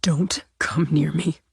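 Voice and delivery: whispered, shaking, backing away; hushed warning. Acted. Simone warning Natalie off, whispered. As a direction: keep her off with a breathless whisper.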